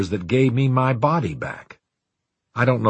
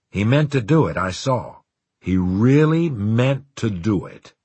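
ordinary delivery, nothing marked

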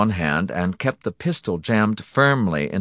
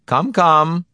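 high, loud, chiding; annoyed; 11 semitones higher, 8 dB louder than usual. A narrator's reading. Donati warning a man about to leap, annoyed. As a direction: chide him with impatient annoyance. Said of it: "looking annoyed".

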